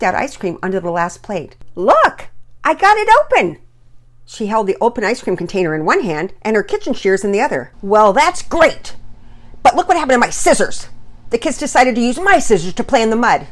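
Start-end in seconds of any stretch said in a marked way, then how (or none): 1.7-3.6 loud, high burst of triumph; excited exclamation. A narrator's reading. Showing the opened ice cream container, excited and loud. 7.8-13.5 loud, high exasperated complaint; an acted dad losing his temper; beside himself. A narrator's reading. Dad complaining the kids took his scissors, beside himself. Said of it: "Daddy Peters was beside himself".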